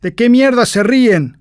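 high, loud furious sergeant yell; sergeant shouting. Acted. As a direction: scream it at the laughing recruits. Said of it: "grita el sargento".

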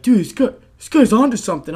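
be the crowd catching on fast; hyped crowd. Retold or imitated.

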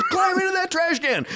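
high, strained sergeant command; chewing out. Retold or imitated. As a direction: imitate a drill sergeant ordering a recruit about. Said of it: "chewing him out".

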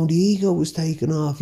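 ordinary delivery

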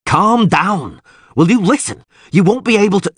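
high, loud, urgent appeal; shouting. A narrator's reading. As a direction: shout to calm her, cut off mid-sentence. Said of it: "he shouted".

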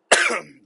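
loud, rough throat clear. Real, not acted. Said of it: "like that".

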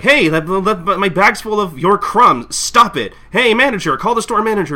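loud, high indignant complaint; acted angry customer. Customer complains of crumbs to clerk, loud acted outrage. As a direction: shout it like an outraged shopper.

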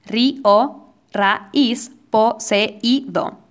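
"Ri, o", "ra, is", each vowel in its own syllable; hiatus syllables. Shown as a demonstration. Poetry teacher showing hiatus examples, syllable by syllable in "Ri, o". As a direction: break "po, se, i, do" into separate syllables with pauses. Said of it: "se ponen en distintas sílabas".

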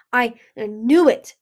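loud, bitter accusation; spat out. A narrator's reading. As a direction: spit it out with a stammered start. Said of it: "Ruby spat".

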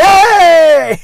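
high, loud cheerful yell; imitated yell. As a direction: call out a big happy hey. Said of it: "He would yell".

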